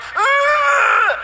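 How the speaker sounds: very high, loud mock baby ugh; high hammed-up baby grunt of protest; baby's angry grunt. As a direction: squeal it like a furious baby. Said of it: "He goes".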